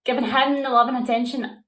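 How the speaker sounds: loud, bitter and furious; angry outburst. Acted. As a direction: spit out the regret. Heated confession to former lover.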